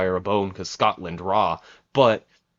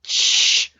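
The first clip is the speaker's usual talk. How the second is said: louder, exasperated "shut up" and shush; 5 dB louder, much slower than usual. Retold or imitated. Fan trying to silence annoying commentators, exasperated shushing. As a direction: snap it, then shush hard. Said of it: "I couldn't even focus. Like, I was just like".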